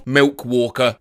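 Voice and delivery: ordinary delivery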